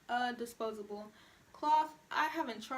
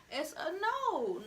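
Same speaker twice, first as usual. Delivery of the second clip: slow, high, drawn-out "It's a no"; drawn-out sassy refusal; emphatic no; 8 semitones higher, 4 dB louder, much slower than usual. Real, not acted. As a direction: shut it down firmly, stretching it. Emphatic rejection after gasp.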